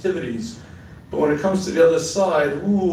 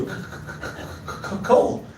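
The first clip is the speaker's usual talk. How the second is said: mock shivering; 3 semitones higher, 4 dB quieter, much slower than usual. Wife shivering on the corner.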